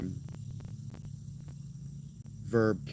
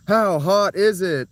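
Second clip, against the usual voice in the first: playful loud chat read; loud high mock exclaim; 9 semitones higher, 9 dB louder, slower than usual. Real, not acted. Reading chat question aloud.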